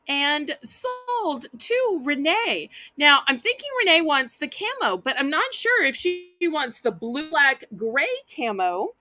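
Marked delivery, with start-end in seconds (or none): 0.1-2.7 slow, stretched auctioneer close; drawn-out sold call. Real, not acted. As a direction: draw out each word of the sale.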